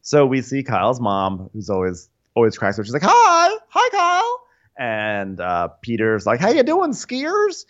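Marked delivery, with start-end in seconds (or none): 3.1-4.4 very high put-on greeting; mock gushing mom. Imitating Kyle's mom on FaceTime, high gushing voice. 6.4-7.7 very high put-on hello; hammed-up imitation, pitched way up; mock hearty greeting. Imitating Peter greeting the skiers, high voice.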